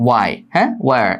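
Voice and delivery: "why" and "where" starting straight on w; silent h. Shown as a demonstration. Teacher proving h is not pronounced, careful pronunciation. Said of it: "এইস এর উচ্চারণ নেই".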